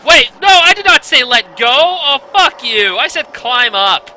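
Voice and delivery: loud, high furious yelling; angry yell. Real, not acted. Player shouting at the game for letting go, furious yell. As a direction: scream at the controls that ignored you. Game did wrong action.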